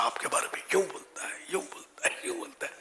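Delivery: hushed, fading whisper of tattle, یوں stressed; gossiping whisper. Retold or imitated. Mimicking gossip behind your back. (سرگوشی میں چغلی کی نقل)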